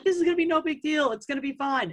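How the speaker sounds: retold carefree dismissal, pitched high; mock breezy confidence. Friends shrugging off lockdown on Saturday, mock breezy confidence.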